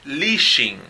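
"leashing" with a hushed sh in the middle; English word demo. Shown as a demonstration. Teacher reading the chart word, careful demo. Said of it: "columna de la tercera fila".

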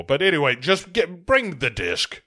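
comic Scottish star impression; Sean Connery. Interrupting Jingo to order the disc, mock impatience. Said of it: "Connery interrupts to be like".

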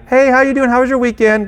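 high, bright mock-friendly hello; sugary fake niceness to a coworker; fake cheerful greeting. Pastor mimicking a fake-friendly hello to a coworker, high and sweet.

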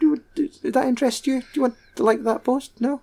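high, chirpy, hammed-up questions; mock chirpy announcer. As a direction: sell a boring fact with fake cheer. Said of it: "mock social media post voice".

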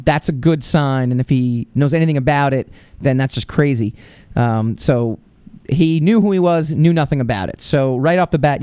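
normal talking voice

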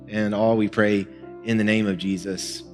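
ordinary delivery

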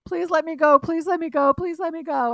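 high, loud frantic begging; imitated desperate pleading. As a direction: imitate a captive girl begging over and over, loudly. Retold plea of crying captive Esme.

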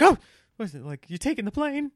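startled gasp into high-pitched questions; mock alarm at someone appearing suddenly; shocked. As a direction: imitate a jumpy, caught-off-guard reaction. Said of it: "she was shocked to see me".

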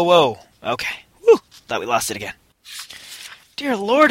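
ordinary talk

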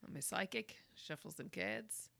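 a hint of a New Zealand psychic; soft New Zealand psychic voice, slowing on the cards; New Zealand accent. Shown as a demonstration. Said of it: "with more New Zealand".

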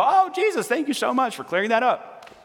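squeaky high sarcastic thanks; mock grateful Pharisees. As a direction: sarcastically play the gratitude they never showed. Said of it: "Did they go".

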